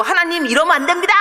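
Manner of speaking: high-pitched mock protest to God; resentful complaint. Preacher mimics immature believer protesting to God, indignant complaint. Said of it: "하나님한테 막 삿대질을 하고 원망하고 불평하고".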